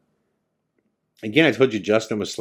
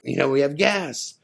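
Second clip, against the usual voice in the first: higher-pitched retold excitement; super pumped; 6 semitones higher than usual. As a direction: imitate a pumped-up friend. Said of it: "he's super pumped".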